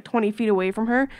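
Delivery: usual speaking voice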